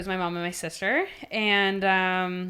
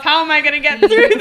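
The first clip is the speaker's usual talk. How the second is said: laughing retelling; 7 semitones higher, 11 dB louder than usual. Retold or imitated. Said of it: "asking myself".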